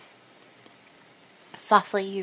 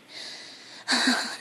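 breathy high sigh; 4 semitones higher than usual. Acted. Erotic scene, breathy sigh.

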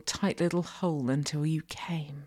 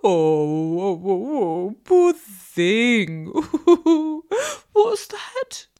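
loud, high mocking laughter and fake pity; 5 semitones higher, 8 dB louder, much slower than usual. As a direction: taunt with exaggerated pity, laughing between phrases. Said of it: "I mock again".

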